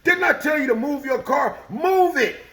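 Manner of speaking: high, loud furious yell; yelling out the window. Retold or imitated. The old man yells out the window after ramming the car. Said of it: "he's yelling out the window".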